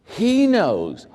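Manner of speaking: high, mock-relieved sighing voices; relieved employees. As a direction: play the staff who are secretly relieved. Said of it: "It would be like".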